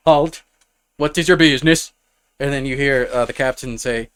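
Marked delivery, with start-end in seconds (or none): 0.1-1.9 a guard barking a loud challenge at strangers; guard's halt call. Acted. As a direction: stop them at the gate, louder.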